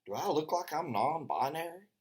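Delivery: hammed-up indignant question; mock indignant. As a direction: play up the offence for a laugh. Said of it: "I said".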